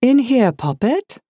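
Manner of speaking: high, affected upper-crust English; aristocratic English accent. A narrator's reading. Liz answers from her home office, manufactured aristocratic English accent. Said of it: "manufactured aristocratic English accent".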